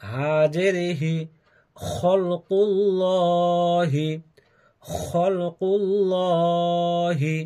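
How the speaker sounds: sing-song syllable-by-syllable spelling chant; rhythmic Qaida reading-lesson chant; Quran spelling drill. Shown as a demonstration. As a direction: spell each letter then blend the word, teacher-style. Teacher spells out Quran words.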